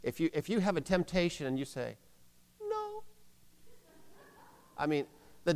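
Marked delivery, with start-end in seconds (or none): 2.6-3.0 tiny, high, faint "No"; half-hearted, very soft and high; not meaning it. Shown as a demonstration. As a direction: squeak out a feeble refusal very softly. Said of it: "you need to do it like you mean it".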